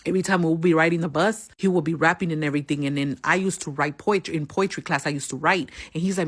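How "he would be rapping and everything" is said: normal talking voice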